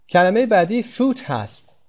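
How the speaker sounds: normal talking voice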